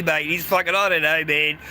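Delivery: normal talking voice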